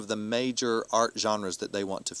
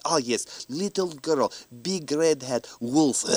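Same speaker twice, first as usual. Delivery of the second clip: put-on light Russian accent, halting words; lightly voiced old Russian student, keyword by keyword; a hint of a Russian accent, high and halting; 4 semitones higher, 3 dB louder, slower than usual. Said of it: "they had heavy accents from Russia".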